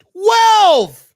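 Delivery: twelve shouted high and loud in disbelief; outraged outburst at the number; shocked shout. Real, not acted. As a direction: yell "Twelve!" as if it can't be true. Repeats the total in disbelief.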